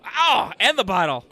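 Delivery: loud surprised cry; excited shout. Real, not acted. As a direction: burst out, delighted. Excited outburst.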